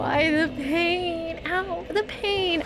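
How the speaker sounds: slow, drawn-out moan; stretched, slightly high whimper of pain; pained whine. Real, not acted. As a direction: wail slowly about the hurt. Hurt feet, drawn-out complaint.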